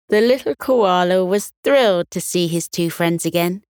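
plain reading voice

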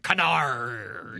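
growled pirate word. Acted. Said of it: "said canard like that".

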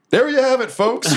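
high, projected announcer voice; arena announcer. Acted. Fight announcer addressing a stunned arena, high showman voice.

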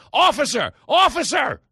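very high, loud calls for an officer; shrill hammed-up cry for help; yelling for the cops. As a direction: yell for the police like the victim. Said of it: "the guy just starts yelling".

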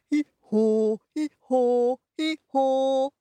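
high hee-haw with long held haws; high-pitched imitation donkey bray. A narrator's reading. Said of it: "I'll be the lead singer".

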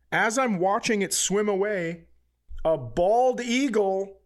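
ordinary talk

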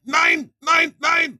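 shouted high, harsh German "Nine, nine, nine"; comic German pope yelling no; German accent shout. Acted. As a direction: bark a German refusal at the top of the voice. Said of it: "Benedict would be like, shout".

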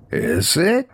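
high, questioning sidekick voice; prankster friend. A narrator's reading. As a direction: lift it high into a sly little question. Roger replies in character voice.

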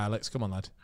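ordinary talk, nothing marked

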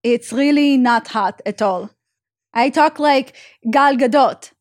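slow, put-on Israeli accent. Shown as a demonstration. Showing how unattractive it sounds, slow Israeli accent. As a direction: imitate Gal Gadot's Israeli accent, drawn out. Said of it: "I can only do Russian or Israeli accent".

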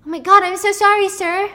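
high, loud, panicky sorry; flustered apology. Retold or imitated. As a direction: gush apologies like a frightened waitress. Waitress spills whiskey on him.

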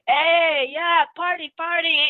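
loud, high-pitched whooping cheer; mock party cheer. Bathroom bystander cheering on the noisy couple, loud mock cheer. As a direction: cheer them on like a rowdy reveler.

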